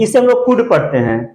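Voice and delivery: normal talking voice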